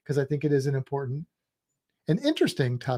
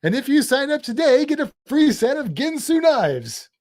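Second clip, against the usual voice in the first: salesman voice; 9 semitones higher, 4 dB louder than usual. Pretend. Continues salesman pitch, louder.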